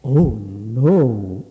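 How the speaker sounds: loud, drawn-out cry of dismay; storybook dismay. A narrator's reading. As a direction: stretch it out in alarm. Bluebird dismayed at being mistaken.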